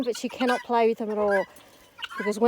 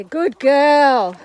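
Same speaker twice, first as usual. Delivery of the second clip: slow praise; 3 semitones higher, 8 dB louder than usual. Real, not acted.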